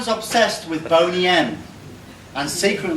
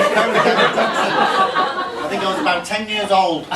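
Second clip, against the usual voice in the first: laughing; 4 semitones higher, slower than usual. Real, not acted. Laughing, slow, high.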